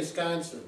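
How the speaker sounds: Sconsin with the first syllable dropped; local clipped pronunciation. Shown as a demonstration. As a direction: say Sconsin, starting straight on the sk. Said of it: "you usually say Sconsin".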